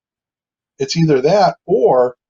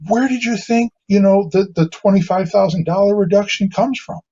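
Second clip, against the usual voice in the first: higher, pointed mock question; incredulous; 7 semitones higher than usual. Painter retells challenging the crying client, incredulous. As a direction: needle her about the missing money. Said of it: "I said".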